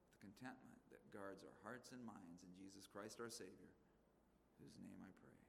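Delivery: soft, low, reverent prayer; closing prayer. Real, not acted. Pastor leading the congregation in a closing prayer, soft and reverent. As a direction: pray it quietly and reverently.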